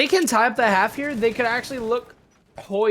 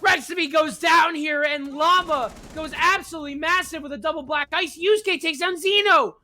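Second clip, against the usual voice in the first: loud, higher, shouted play-by-play; excited casting; 8 semitones higher, 4 dB louder than usual. Acted. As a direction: call the round at full hype.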